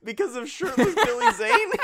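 squeaky high laugh through words. Real, not acted. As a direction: burst out laughing mid-question. Joking about shirtless Billy Zane.

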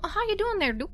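put-on high, fast chipper mail boy voice; Canadian mail boy. GM voicing Cliff, Canadian mail boy.

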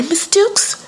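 high-pitched call for a parent; hammed-up high voice, calling out; alarmed teacher. Teacher calling the girl's mother after the punch, alarmed.

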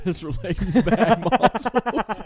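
high, drawn-out words through laughter; laughing. Real, not acted. Friend recapping the hug story, helpless laughter. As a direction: crack up while saying it, pitch climbing.

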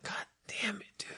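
breathless whispered curse under his breath; whispered awe. Retold or imitated. Guy ogling a girl online, whispering in awe. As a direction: hush it like you can't believe her looks. Muttering about a hot girl.

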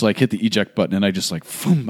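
ordinary talk

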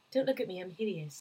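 low, very soft mock shame; imitating ashamed Hermione. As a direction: shrink away in a small, hushed quote. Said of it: "she's just like".

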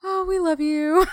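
gushing. Retold or imitated. Gushing thanks to the cleaner.

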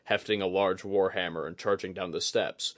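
ordinary delivery, nothing marked

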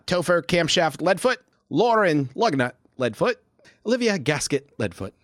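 high character voice; dwarf driver voice. Acted. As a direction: announce names like a driver. GM voices Camshaft.

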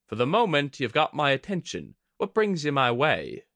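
amused woman voice. Acted. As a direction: smirk it out, intrigued. Kudaka amused.

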